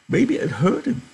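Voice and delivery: put-on very high, strained old man's voice; cling to the dog with every word; pleading old Candy. Old Candy begging to keep his dog, very high.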